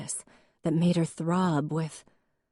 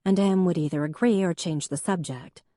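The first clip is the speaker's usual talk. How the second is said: ordinary delivery, nothing marked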